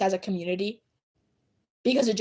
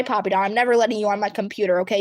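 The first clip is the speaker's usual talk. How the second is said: ordinary talk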